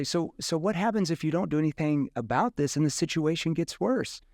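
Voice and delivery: a challenging sales question. Shown as a demonstration. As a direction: press them on the cost of waiting. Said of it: "that's a kind of a challenging tone".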